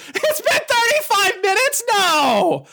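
high, drawn-out wail of disbelief; mock disbelief. Bored viewer checking the watch, mock-horrified. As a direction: squeal it out slowly in mock agony. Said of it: "it's like".

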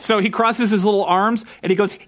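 ordinary delivery

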